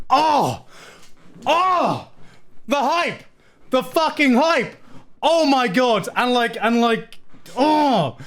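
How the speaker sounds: high, excited yelps of hype; hyped up. Real, not acted. Fan recalling Sogeking's scene, high excited cries. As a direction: cheer it like the best moment ever. Shouting about a hype moment.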